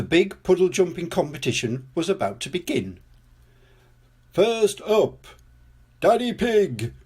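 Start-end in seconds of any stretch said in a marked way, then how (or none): 4.3-6.9 high-pitched contest announcer; crying out. A narrator's reading. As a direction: call out the contestant with fanfare. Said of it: "cried Mister Potato".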